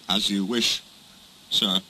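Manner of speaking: low, resigned acceptance; obey with subdued sadness; dejected. Acted. Duck accepts being sent away by the controller, dejected.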